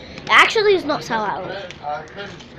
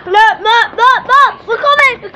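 excited calling; 6 semitones higher, 7 dB louder, slower than usual. Real, not acted.

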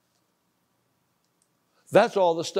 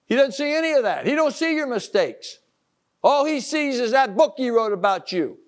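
raised, driving preacher's exhortation; preaching at full force; 9 semitones higher, 5 dB louder than usual. Acted. Preacher exhorting his listeners, loud and insistent. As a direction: push it home like a revival sermon.

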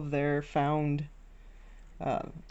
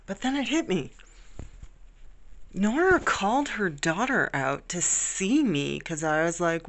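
ordinary delivery

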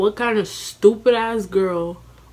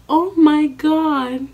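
slow, loud, high "Oh my God"; mock shock at what she sees; stunned mom exclaiming; shocked gasp; 8 semitones higher, 7 dB louder, much slower than usual. Mom walks in on them.

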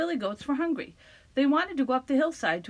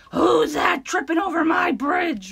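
higher, loud shouted roar; roaring troll; 6 semitones higher, 8 dB louder than usual. Acted. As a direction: roar it at the intruder. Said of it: "roared the troll".

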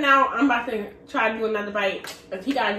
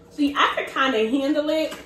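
ordinary talk, nothing marked

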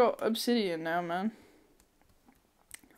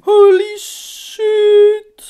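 high, loud, long drawn-out words; astonished shout; 10 semitones higher, 15 dB louder, much slower than usual. Real, not acted. Gamer stunned by the loot, astonished shouting. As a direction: stretch it out loudly in amazement.

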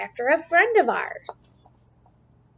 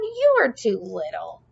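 lightly voiced grumpy leprechaun, dismissive; a hint of a scornful old grump; 4 semitones lower than usual. Grumpy leprechaun brushing off little Liam, lightly voiced scorn. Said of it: "said the grumpy leprechaun".

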